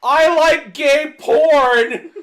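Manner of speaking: huge high yell through a laugh; mock screamer blast; booming shout. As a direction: blast it like a prank jump scare. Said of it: "the loudest fucking voice ever just goes".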